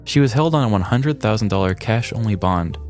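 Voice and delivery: usual speaking voice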